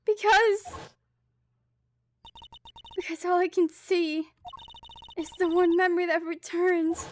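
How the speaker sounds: put-on a young thief crying out her guilt; impassioned girl on the verge of breaking; emotional Kay.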